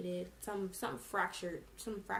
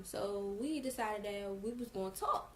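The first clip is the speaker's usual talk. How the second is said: ordinary delivery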